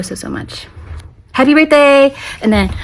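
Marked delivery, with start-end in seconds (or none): 0.0-0.7 whisper. Real, not acted. Fading out a love message. 1.3-2.1 excited. Real, not acted. Birthday greeting burst.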